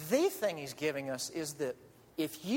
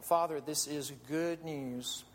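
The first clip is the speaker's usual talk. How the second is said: prayer; 4 semitones lower than usual. Acted. Pastor leads closing prayer.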